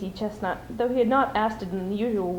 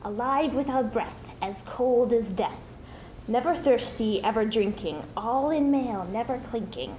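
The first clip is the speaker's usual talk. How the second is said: slow riddle in a slightly raised Gollum voice; a hint of Gollum, teasing slowly; lightly voiced Gollum riddle. Gollum asks his riddle.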